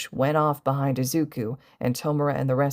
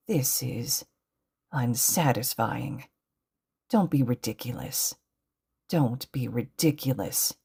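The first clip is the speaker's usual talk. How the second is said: quiet displeasure; 2 semitones lower, 4 dB quieter than usual. A narrator's reading. Villain seethes quietly to himself.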